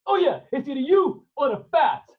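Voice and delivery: bully him; menacing sailor. Acted. Gentile threat redone.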